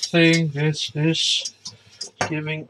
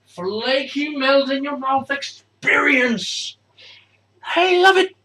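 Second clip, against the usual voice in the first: excited; 8 semitones higher, 6 dB louder, slower than usual. Real, not acted. Praising the food.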